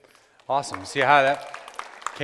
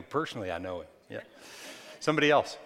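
normal talking voice

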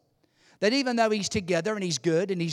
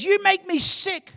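high, raised-voice exasperation; acted angry dismissal; disgusted outburst; 13 semitones higher, 6 dB louder than usual. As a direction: spit it out as the fed-up brother. Brother storms off in disgust.

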